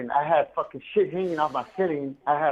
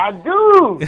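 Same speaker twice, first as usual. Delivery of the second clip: high, loud protest; loud retort; 8 semitones higher, 11 dB louder than usual. Real, not acted. Protesting to his friend, loud and high. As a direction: fire back, loud.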